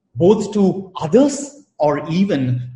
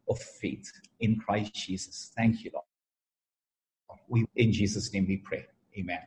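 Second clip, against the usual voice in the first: soft, hushed prayer voice, drifting quieter; opening prayer; 4 semitones lower, 4 dB quieter than usual. Acted. Pastor praying softly over his church before the sermon. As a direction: pray gently over the congregation.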